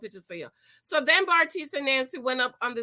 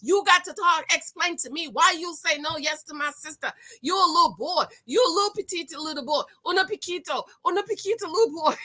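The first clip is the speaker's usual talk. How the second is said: higher, taunting hammed-up brother; mock taunt rising into teasing laughter; mock tough brother; 4 semitones higher than usual. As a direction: sneer at the groom like a tough big brother. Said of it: "And the brother's like".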